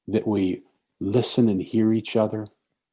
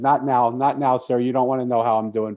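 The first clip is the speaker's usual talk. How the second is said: louder, urgent plea to be left alone; desperate pleading; 2 semitones higher, 7 dB louder than usual. Retold or imitated. Homeless man begging a stranger to walk on, raised plea. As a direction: beg him to go away, voice raised.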